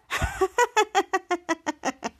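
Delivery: long, put-on descending laugh; imitated laughter. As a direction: act out a run of ha-ha laughter. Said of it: "Charlotte begins to laugh".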